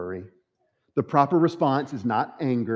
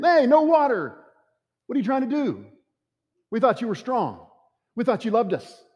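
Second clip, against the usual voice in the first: high, loud whiny complaint; mocking whiny complainers; 9 semitones higher, 5 dB louder than usual. Preacher mimics the Israelites grumbling at God, high whiny mock complaint. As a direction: mimic the grumbling crowd, pitched well up and louder.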